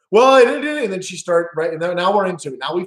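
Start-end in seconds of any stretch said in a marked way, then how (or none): 0.1-0.8 mock shout. Husband shouting his point.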